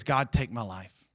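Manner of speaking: low, soft, defeated plea; mildly acted despair, voice dropped; depressed prayer. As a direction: pray it as if given up. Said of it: "he's depressed".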